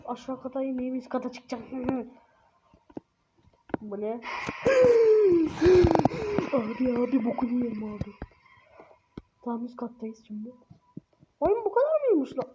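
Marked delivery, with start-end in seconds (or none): 4.2-6.5 loud high gasp breaking into a laugh; gasp into laughter. Real, not acted. Frightened by something upstairs, gasping then laughing. 11.4-12.5 much higher, incredulous squeak; surprised. Real, not acted. Finding the game already over, asking in surprise.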